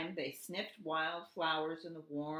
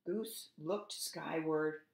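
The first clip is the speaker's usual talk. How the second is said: plain reading voice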